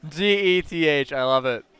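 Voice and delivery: usual speaking voice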